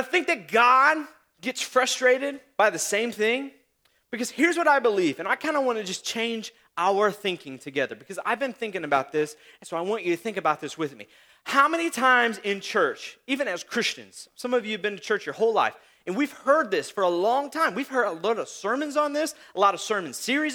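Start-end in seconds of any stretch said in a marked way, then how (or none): none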